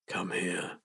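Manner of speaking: low, soft command; changed voice. Acted. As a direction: drop low and quiet, almost not your own voice. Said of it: "His voice didn't sound like his own".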